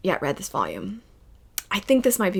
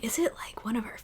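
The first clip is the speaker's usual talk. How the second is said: hushed whisper, as if sharing a secret; 2 semitones higher, 13 dB quieter, faster than usual. Retold or imitated. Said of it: "My husband and I are like".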